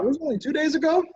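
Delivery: high, quick, incredulous question; real high-pitched disbelief; surprised. Real, not acted. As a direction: ask in real astonishment, pitch leaping up. Reacts to guest's very recent start.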